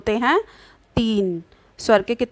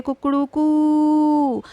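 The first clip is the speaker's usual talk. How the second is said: Kukru कू higher, with the last कू drawn out slowly; rooster crow imitation; 5 semitones higher, much slower than usual. Shown as a demonstration. Teacher imitating a rooster crow to show a prolonged vowel. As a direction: crow like a rooster at dawn, stretching the end. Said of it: "मुर्गे की बांग".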